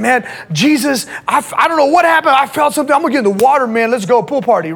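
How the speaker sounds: high, quickened outburst of excitement; excited new convert. Retold or imitated. New convert jumping into the baptism pool, excited. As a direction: blurt it out, imitating an overjoyed convert.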